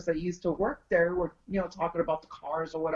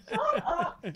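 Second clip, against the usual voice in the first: high, laughing protest. Retold or imitated. Telling boyfriend to stop teasing, laughing. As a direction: giggle it out, embarrassed.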